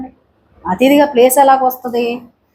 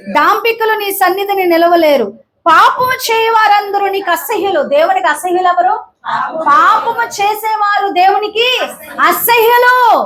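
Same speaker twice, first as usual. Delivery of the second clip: higher, rising preacher's voice; fervent preaching; 7 semitones higher than usual. Acted. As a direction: preach it with mounting fervour. Preaching builds to high pitch.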